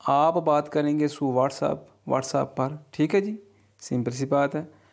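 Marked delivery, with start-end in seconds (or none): none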